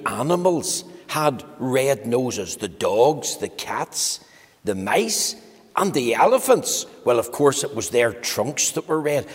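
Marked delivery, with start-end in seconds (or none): none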